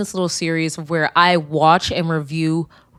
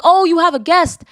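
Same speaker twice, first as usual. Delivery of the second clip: 1 acted meme quote; 9 semitones higher, 4 dB louder than usual. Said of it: "they're like".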